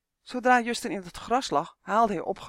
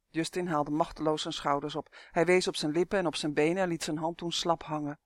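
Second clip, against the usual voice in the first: plain reading voice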